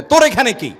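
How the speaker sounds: put-on loud, high, angry bark at the child; scolding shout. Grandfather shouting at the boy who broke his fast, loud and high. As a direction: yell at the boy to get out of the way. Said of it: "দাদা এক ধমক দেয়".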